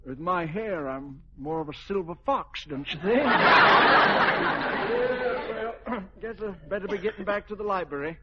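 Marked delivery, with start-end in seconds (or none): 3.3-5.5 loud hearty laugh. Acted. General laughs at his own joke.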